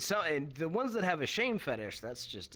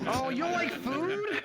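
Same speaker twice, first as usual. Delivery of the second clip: mock incredulous; 4 dB louder, slower than usual. Comic mock reply to a fan.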